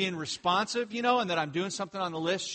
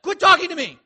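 loud, high, exasperated outburst; lashing out; 13 dB louder than usual. Retold or imitated. Husband fixing a cabinet, lashing out at his pestering wife. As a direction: snap at her to be left alone. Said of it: "I will lash out and just be like".

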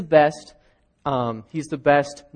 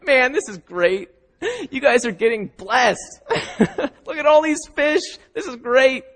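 higher, laughing through the words; a joyful teacher enjoying the catch; laughing delight; 8 semitones higher than usual. Acted. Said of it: "Jesus is like".

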